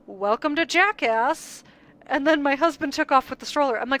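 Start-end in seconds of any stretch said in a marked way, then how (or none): 0.1-1.6 higher, drawn-out toddler imitation; slow, raised-pitch little-kid voice; imitated toddler. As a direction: mimic a toddler proudly repeating a phrase. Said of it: "my toddler looks at me and goes".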